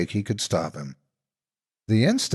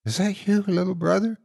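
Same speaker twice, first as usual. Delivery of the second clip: much higher, trembling question; scared whisper into the dark; frightened question; 11 semitones higher than usual. A narrator's reading. Terrified Shaky asking for his brother in the dark.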